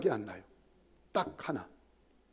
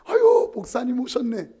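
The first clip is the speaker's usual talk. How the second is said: tipsy greeting; 6 semitones higher, 9 dB louder than usual. Retold or imitated. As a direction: greet the pastor like a happy drunk. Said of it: "반가워하면서 막 오는데". (술 취한 형님이 목사를 반기는 느릿한 높은 목소리)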